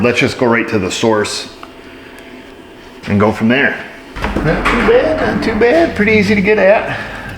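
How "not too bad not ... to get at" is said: squeaky high voice. Pretend. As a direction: squeak it out like a cartoon. Mechanic jokes in squeaky voice.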